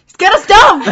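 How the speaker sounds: loud, high impatient yell; exasperated shout. Real, not acted. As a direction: shout it out to get things moving. Impatient prompting during a giggle fit.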